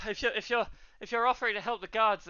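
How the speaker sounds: high, stammering townswoman; flustered barwoman. Acted. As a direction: speak up urgently in a high voice. Voices alarmed barwoman.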